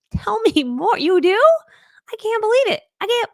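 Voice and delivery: hammed-up overjoyed fan-girl squeals; thrilled gushing. Creator hearing her fans say I love you, thrilled high gushing.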